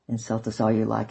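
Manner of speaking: plain reading voice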